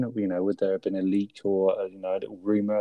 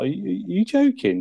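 mock disbelief; 10 semitones higher than usual. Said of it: "He's like".

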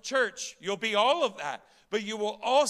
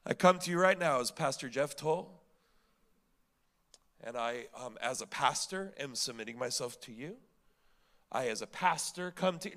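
mock-formal pastor's prayer; 6 semitones lower than usual. Pastor presenting his title to God, mock-formal prayer. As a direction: pray it stiffly, parodying your own title. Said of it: "I don't come to God in the morning as pastor".

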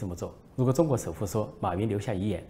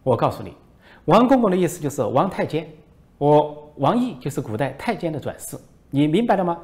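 mock lecture; 6 semitones higher, 7 dB louder than usual. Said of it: "王毅说".

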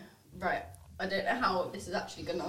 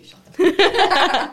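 loud, slow laughing yell; laughing shout; 7 semitones higher, 16 dB louder than usual. Real, not acted.

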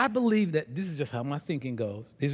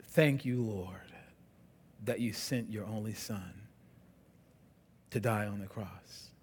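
closing prayer; 6 semitones lower, 5 dB quieter than usual. Real, not acted. Pastor leading the church in closing prayer, soft and reverent. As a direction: pray quietly over the congregation.

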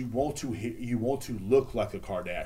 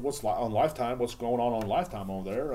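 normal talking voice

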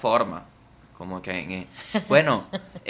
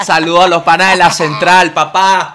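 calling out; 3 semitones higher, 9 dB louder than usual. Pretend. Podcaster greets the pals at the central, calling out loudly. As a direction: holler a greeting to distant pals.

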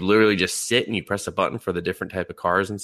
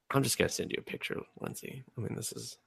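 soft, fast, half-whispered mutter under the breath; exasperated mutter to herself; muttered aside; 13 dB quieter, faster than usual. Real, not acted. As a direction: grumble it to yourself, barely voiced.

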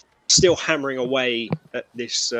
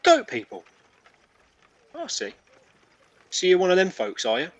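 higher, wary "so you're one of them"; suspicious goat man; 7 semitones higher than usual. Acted. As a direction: hold him at arm's length with suspicion. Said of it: "he goes".

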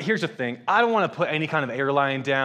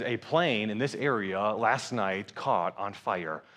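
slow, low, soft newsreader; news report; 6 semitones lower, 6 dB quieter, slower than usual. Retold or imitated. Local newsreader reporting a plane fire, slow and low. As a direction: read it out gravely like breaking news.

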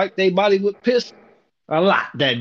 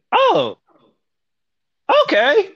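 much higher, feigned sweet surprise; mock surprised oh okay; 3 dB louder, slower than usual. Coworker dumps towels on him, he feigns surprise, much higher. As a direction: play innocent, bright and high.

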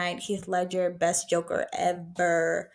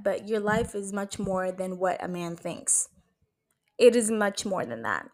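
ordinary talk, nothing marked

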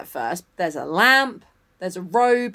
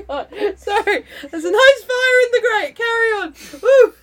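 very high, squeaky laughing voice; real giggling fit; 13 semitones higher, 7 dB louder than usual. Real, not acted. Co-host apologising to the reader mid-giggles, laughing. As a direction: squeak it out through helpless laughter.

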